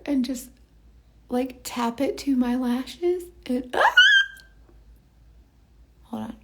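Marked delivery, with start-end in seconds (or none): 3.7-3.9 short, sudden "ah" of surprise; startled little cry. Real, not acted. Lash artist fumbling while tapping lashes on, startled cry. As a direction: yelp briefly as something goes wrong.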